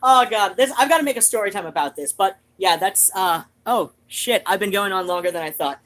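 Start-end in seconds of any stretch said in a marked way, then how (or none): none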